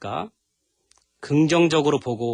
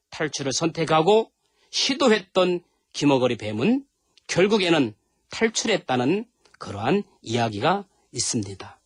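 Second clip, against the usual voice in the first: usual speaking voice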